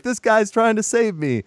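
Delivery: high-pitched mock announcement to everyone; retold mock yell. Retelling his jeer to everyone, high mock yell. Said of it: "he yells it to everyone".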